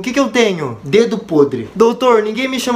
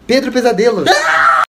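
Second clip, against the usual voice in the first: shouted punchline. Acted.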